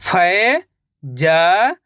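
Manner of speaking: Faizal drawn out slowly, syllable by syllable, rising at the end; stretched syllables. Shown as a demonstration. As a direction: sound out Faizal piece by piece. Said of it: "तो मिलके बन जाएगा फैजल".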